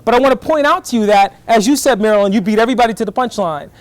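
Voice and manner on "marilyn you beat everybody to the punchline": normal talking voice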